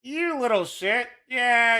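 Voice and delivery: ordinary talk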